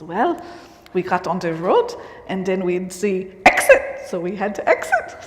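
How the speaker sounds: imitated German accent with a punched "exit"; put-on German accent for a retold punchline. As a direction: play the German father-in-law, accent and all. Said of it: "his father-in-law was German".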